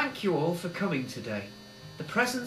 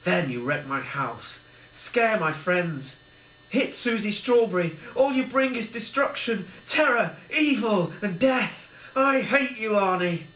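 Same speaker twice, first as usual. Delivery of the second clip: builds high and loud in anger; Billy condemning Arnie's murders; rising fury; 6 semitones higher, 3 dB louder than usual. A narrator's reading. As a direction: turn on the killer with hate. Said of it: "sensed a change of tone".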